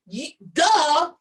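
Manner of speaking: usual speaking voice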